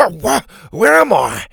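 high, loud, sputtered question; coughing. A narrator's reading. Doctor Rex revives after splashed water, coughing and confused. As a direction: splutter awake with a cough. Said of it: "he coughed".

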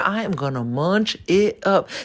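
usual speaking voice

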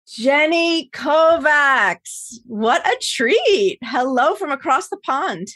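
delighted host welcoming a guest; excited greeting. Real, not acted. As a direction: call out her name like a showhost.

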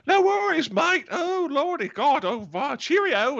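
very high, loud, hammed-up Australian; mock Aussie holler, absurdly overdone; terrible Australian accent. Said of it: "that was the worst Australian accent I've ever heard".

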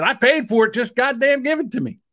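high, fed-up just-give-it-to-me; exasperated demand. Retold or imitated. Customer telling the worker to hand over both orders, exasperated. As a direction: throw up your hands at the worker. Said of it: "I said".

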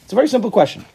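asking a busy guy to glance at the verse, coaxing. Retold or imitated. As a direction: coax him to look for a second. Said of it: "You say".

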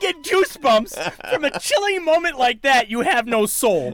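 high, excited build to a cry; thrilled outburst. Real, not acted. Film fan reliving the finger touch for co-host, thrilled. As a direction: gush with goosebumps, voice climbing to a squeal.

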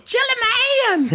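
high, slow mimicked holler; mimicking her piercing call; imitated call-out. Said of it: "I'll always remember her going".

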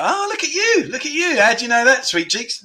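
high, delighted praise. Real, not acted. Praising a viewer who knew the word, high and delighted. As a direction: praise him like a proud teacher.

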